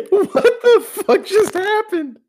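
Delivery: high, slow, laughing shock; laughing disbelief. Real, not acted.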